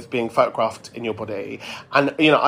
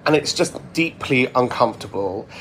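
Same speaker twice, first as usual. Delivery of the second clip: ordinary talk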